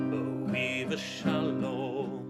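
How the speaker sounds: usual speaking voice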